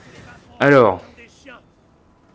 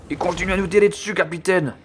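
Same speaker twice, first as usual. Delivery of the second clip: high, hurried comic underling voice; alarmed soldier; 9 semitones higher, 4 dB louder than usual. Pretend. Soldier warning captain of incoming fire, mock alarm. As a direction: report in mock panic.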